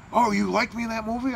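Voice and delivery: high-pitched retold pleased question. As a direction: imitate someone lighting up at a compliment. Acting out [name]'s delighted question.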